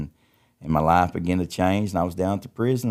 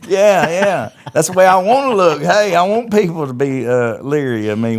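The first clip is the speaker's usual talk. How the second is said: put-on much higher and louder, brash kid voice; mock the swagger of his young self; brash retold teen answering mom; cocky teenager; 10 semitones higher, 9 dB louder than usual.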